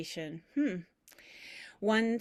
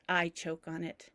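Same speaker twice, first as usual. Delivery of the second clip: ordinary delivery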